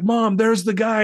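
put-on a child pointing him out to her mom, eager; excited little girl. Little girl spotting him in church.

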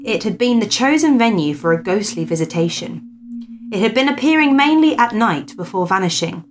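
lifted, measured storyteller's voice; story narration. Acted. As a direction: read the old ghost tale aloud with emphasis. Reads a ghost story aloud.

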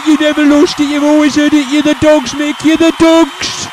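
screaming fan. Pretend. Pretend fans cheering.

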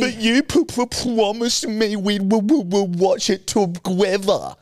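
high, slow stammering mock whine; whiny stuttering impression. Mocking Louise's whine about watching together, stammered. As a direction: mimic her stammering over promised and watch. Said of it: "and you'd go".

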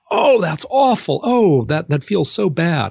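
high, crying wail of hurt; pained cry. Retold or imitated. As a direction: moan it like it really hurts. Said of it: "you would say".

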